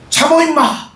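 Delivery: mock scolding of a hungry child; scolding parent. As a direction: bark at the kid to wait. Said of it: "막 애들한테 그러지 않잖아요".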